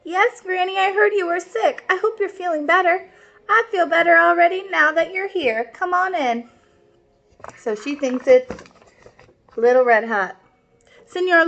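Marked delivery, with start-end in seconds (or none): 0.0-3.1 high put-on little girl voice from the wolf; the wolf pretends to be the girl, pitched high; wolf faking a girl's voice. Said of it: "made his voice sound like Little Red Hot's". 3.5-6.5 grandma voice. Acted. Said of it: "Grandma said".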